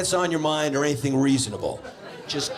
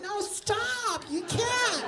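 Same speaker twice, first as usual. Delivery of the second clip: high falsetto mock wife voice; 4 dB quieter, much slower than usual. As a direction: squeak it out like a mock girlfriend. Mimicking wife's flattered reply.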